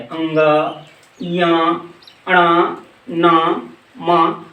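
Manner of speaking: अंग, य, अण, न, म drawn out through the nose; nasal sounds demo. Shown as a demonstration. Teacher sounds out the nasal letters for students, slow and through the nose. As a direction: hum अण and न slowly through the nose. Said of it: "नाक से निकलता है".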